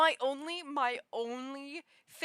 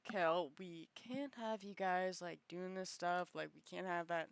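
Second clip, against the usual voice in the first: a calm stern leader, lightly lower; Hero voice; 5 semitones lower, 6 dB quieter than usual. Pretend. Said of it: "Hero's just my other voice".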